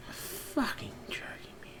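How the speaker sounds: slow, breathless whisper of disbelief. Real, not acted. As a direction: hiss it under your breath, slowly. Muttering at login prompt.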